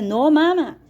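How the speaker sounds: calling out loud and high to a half-deaf mother. Retold or imitated. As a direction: raise your voice so mum can hear. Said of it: "yo alcé la voz para que ella me oyera".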